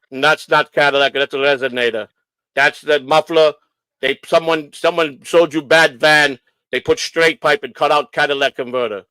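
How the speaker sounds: put-on deliberate Polish-accented English. Inspector explaining the swapped pipe, Polish accent. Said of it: "he sounded Polish to me".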